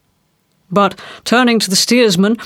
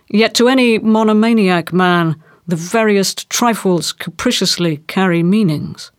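ordinary delivery

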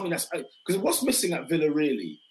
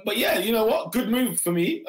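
ordinary talk

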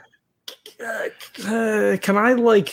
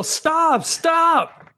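yell; 11 semitones higher than usual. Acted. Player character yells at the villagers to stop, shrill shout. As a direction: scream at them to stop firing. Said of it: "I think I just yell".

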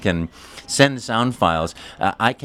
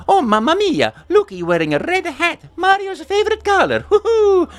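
very high, louder Italian-accented plumber; Mario voice; 4 dB louder than usual. Shown as a demonstration. Mario delighting a child in a red hat. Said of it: "I can say".

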